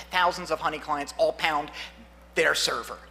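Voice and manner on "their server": ordinary talk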